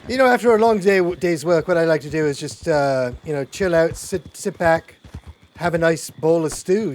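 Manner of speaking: hammed-up loud. Loud laid-back speech about stew.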